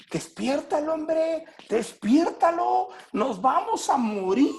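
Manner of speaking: high, frantic cry to wake him; high panicked shout, slowing on the second despiértalo; panicked plea. Acted. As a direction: beg in terror that you'll drown. Panicked apostles in the boat.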